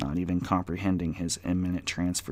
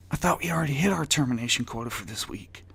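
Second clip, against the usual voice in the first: nervous, higher voice of a scared clerk; anxious office worker, voice pitched up; terrified mumble; 7 semitones higher, faster than usual. Acted. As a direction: mumble it like a frightened employee. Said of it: "mumbled Andy, terrified".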